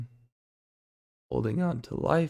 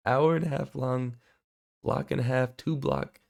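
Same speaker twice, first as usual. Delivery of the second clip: ordinary talk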